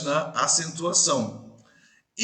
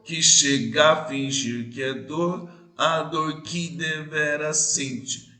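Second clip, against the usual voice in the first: "Que chega a fingir que é dor" with the third syllable leaned on, slowing toward the end; stress on the third syllable; slower than usual. Shown as a demonstration. Teacher to class, reading the verse in its rhythm. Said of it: "Na terceira sílaba é mais forte".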